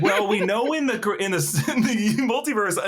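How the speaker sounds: real giggle breaking up the words; laughing through words. Real, not acted. Podcaster laughing at his own awkward example, giggling. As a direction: laugh your way through the sentence.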